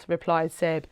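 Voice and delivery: plain reading voice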